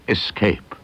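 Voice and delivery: usual speaking voice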